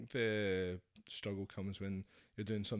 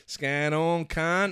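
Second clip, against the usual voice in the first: slow, drawn-out mock Aussie accent, a bit louder; 5 semitones higher, 8 dB louder, much slower than usual. Shown as a demonstration. Asked by the host to say it again to camera, slow hammed-up Aussie accent. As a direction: stretch every word in a hammed-up Aussie accent. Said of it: "look into that camera and do it again".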